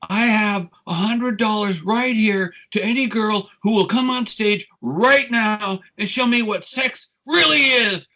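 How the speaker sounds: high, loud showman's proclamation; stage announcer. Retold or imitated. Stan Lee offers money to the audience from the stage, high loud proclamation. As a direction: call it out drawn-out to a whole crowd. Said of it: "he says to the audience".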